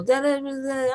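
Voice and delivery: mock her son's jumbled baby talk; imitating toddler babble. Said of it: "he'll go".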